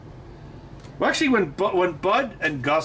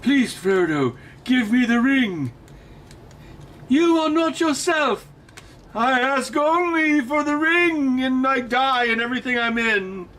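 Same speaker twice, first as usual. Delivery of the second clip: Sean Bean; 8 semitones higher than usual. Acted. Streamer as actor begging Frodo for the ring, high pleading impression. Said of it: "Sean Bean?".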